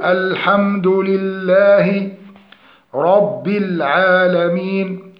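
slow الحمد لله رب العالمين in measured recitation; correct recitation. Shown as a demonstration. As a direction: model الحمد لله رب العالمين with even long vowels. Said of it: "هنا في مد لام لفظ الجلالة". (تلاوة تعليمية بطيئة)

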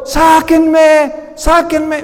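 desperate shout. Retold or imitated. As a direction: yell to be let out, pleading. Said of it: "él gritando".

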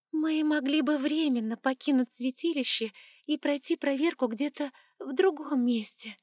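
usual speaking voice

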